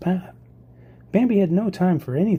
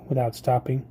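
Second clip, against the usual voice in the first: ordinary delivery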